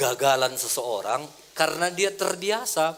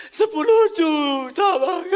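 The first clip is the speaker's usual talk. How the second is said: laughing while quoting; 9 semitones higher, 7 dB louder than usual. Retold or imitated. Man on phone, pastor laughing.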